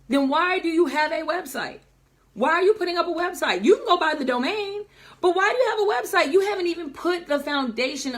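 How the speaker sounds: high, fast, emphatic grilling; fired-up, pressing rhetorical questions; exasperated challenge. Real, not acted. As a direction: challenge them in rising exasperation. Challenging clients who skip the foundation.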